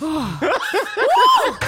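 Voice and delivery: loud, high laughing woo; high whoop. Retold or imitated. Host whooping as Villanelle in excitement. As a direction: whoop with glee.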